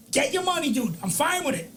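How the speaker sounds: ordinary delivery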